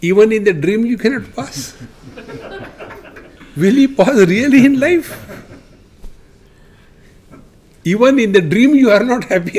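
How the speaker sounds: high, loud teasing laughter; mocking the child while laughing out loud. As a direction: laugh at the child's dream failure. Father mocks the failed dream.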